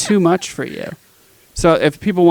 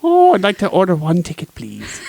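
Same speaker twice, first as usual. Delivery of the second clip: mock Irish accent. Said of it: "my Irish accent was apparently so strong".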